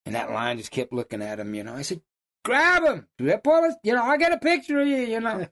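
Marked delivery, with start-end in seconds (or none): 2.4-5.5 high shouted call up the tree; high, loud retold yell to the hunter. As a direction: holler up at the hunter.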